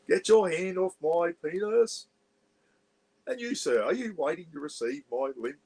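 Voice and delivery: grand posh Englishman protesting his arrest; posh Pommy accent. Retold or imitated. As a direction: declaim in mock posh English outrage. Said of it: "putting on this pom-, posh Pommy accent".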